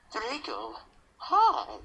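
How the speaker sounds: acted depressed greeting, pitched high. Shown as a demonstration. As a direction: greet Draco with put-on gloom. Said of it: "I'll say more depressed".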